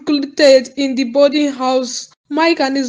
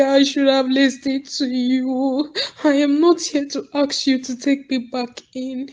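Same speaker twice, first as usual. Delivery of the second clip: ordinary delivery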